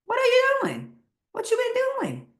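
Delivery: high squeal of amazement, hammed up; squeaky amazed doctor. Doctor squealing at the patient's good numbers, hammed-up high voice. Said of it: "your doctor is asking".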